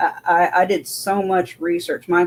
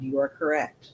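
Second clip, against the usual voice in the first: quiet confirmation; 3 semitones lower, 6 dB quieter than usual. Retold or imitated. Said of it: "He said".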